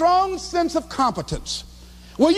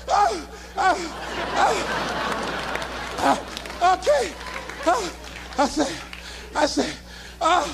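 stunned retold stammer; 4 semitones higher, 4 dB quieter, slower than usual. Acting out stunned stammer onstage.

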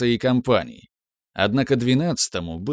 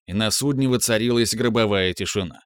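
plain reading voice

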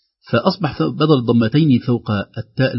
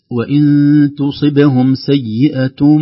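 slow chanted recitation, louder; Quran recitation; 4 semitones higher, 7 dB louder, much slower than usual. Shown as a demonstration. Teacher recites a verse for the lesson. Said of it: "لاحظوا في المصحف".

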